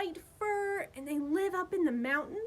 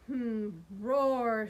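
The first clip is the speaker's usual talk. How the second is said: low gentle roar; 4 semitones lower than usual. Acted. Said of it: "said Yeti".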